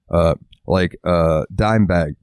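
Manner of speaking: ordinary delivery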